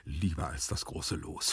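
low, soft lovesick musing; dreamy continuation. A narrator's reading. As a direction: murmur it longingly, deep and soft. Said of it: "fuhr Gumpellino fort". (leise, tief und schwärmerisch)

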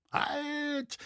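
very high, thin-volumed cry of halt; interjected cry. A narrator's reading. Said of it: "rief der Schneider darein". (hoch dazwischengerufen)

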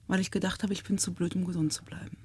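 soft, fading to a whisper; quiet confession. Real, not acted. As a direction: let it sink into a hushed whisper. Admitting shame about diagnosis.

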